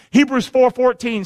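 ordinary talk, nothing marked

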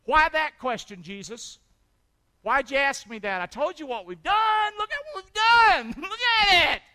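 high, loud and indignant; indignant protest. Acted. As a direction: protest to Jesus, half laughing. Protests to Jesus.

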